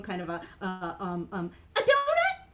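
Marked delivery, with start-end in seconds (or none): 1.8-2.5 high, loud puppet guess; Mimi. Acted. Mimi guesses at crumpets, high puppet voice. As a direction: pipe up in a tiny character voice.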